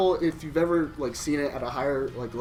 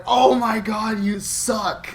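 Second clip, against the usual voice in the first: mocking; 6 semitones higher, 6 dB louder, much slower than usual. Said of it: "people were laughing at me and going".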